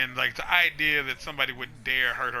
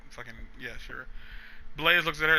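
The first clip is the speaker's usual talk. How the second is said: normal talking voice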